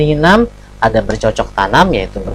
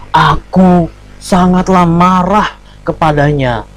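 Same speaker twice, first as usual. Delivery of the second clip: loud, slow, high-pitched angry declaration; 4 semitones higher, 3 dB louder, slower than usual. Shown as a demonstration. Teacher showing pupils how angry poetry lines should sound, angry. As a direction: declaim it in anger, drawing the words out. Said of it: "misalnya lagi marah".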